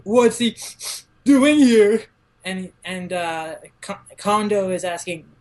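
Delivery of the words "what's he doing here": higher, louder outraged question; indignant surprise. Retold or imitated. Harada spotting someone unwelcome, indignant. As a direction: demand it in disbelief. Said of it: "And Harada's like".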